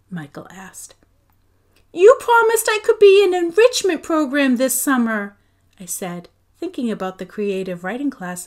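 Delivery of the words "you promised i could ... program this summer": upset pleading. A narrator's reading. Girl pleads with mom.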